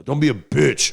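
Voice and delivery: loud taunting dad; harsh dad. Retold or imitated. Imitating his dad taunting him, loud mock. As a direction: sneer it like a bullying father. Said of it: "You know".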